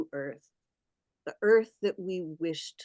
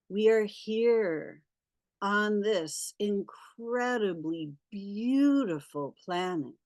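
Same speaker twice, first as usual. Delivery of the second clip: guided meditation. Acted.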